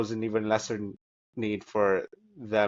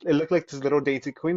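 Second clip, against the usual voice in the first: normal talking voice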